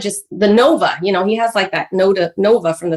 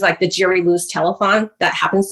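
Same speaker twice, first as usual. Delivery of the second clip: ordinary talk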